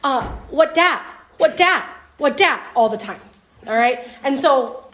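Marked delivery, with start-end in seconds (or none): 0.5-2.6 put-on toddler voice. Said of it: "she does go".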